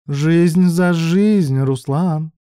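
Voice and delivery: very slow, high, drawn-out menace; menacing villainess. A narrator's reading. Bruk offers Ruslan a deadly trade, menacingly. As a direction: stretch each word into a loud threat. Villainess threatens hostages by phone.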